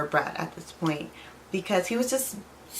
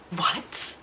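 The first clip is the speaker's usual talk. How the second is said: breathless shocked whisper of disbelief; a gasped whisper, hit by the news; stunned whisper. Retold or imitated. Hearing the ex news, she whispers in shock.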